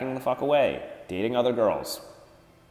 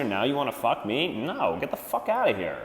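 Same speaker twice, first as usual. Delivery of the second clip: usual speaking voice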